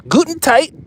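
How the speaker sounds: mock German torque talk; hammed-up German accent; joke German accent. Shown as a demonstration. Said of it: "what I'm saying is good and tight with a German accent".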